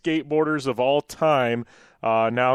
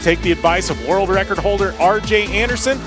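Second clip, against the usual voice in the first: ad read; 3 semitones higher than usual. Acted. Reads a sponsor ad.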